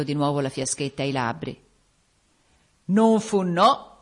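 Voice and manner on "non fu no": loud, weighty ghostly denial; ominous ghostly voice. A narrator's reading. Unseen spirit answers Gabriele, ominous voice. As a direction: contradict him firmly, from out of nowhere. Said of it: "disse una voce profonda".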